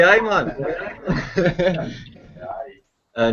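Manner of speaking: ordinary talk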